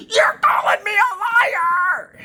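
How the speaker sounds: hammed-up hysterical scream; imitating a screaming woman. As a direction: shriek it like she did at the lawyer. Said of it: "she yelled and screamed at our lawyer".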